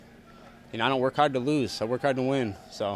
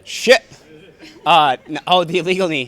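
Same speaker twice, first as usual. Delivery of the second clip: sudden realization; 5 semitones higher, 10 dB louder than usual. Real, not acted. Reminded of forgotten illegal knee.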